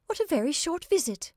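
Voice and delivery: high-pitched, dancing amusement; amused young woman. A narrator's reading. Anne teases her departing visitor, high and amused. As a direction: exclaim it high, on the edge of laughter.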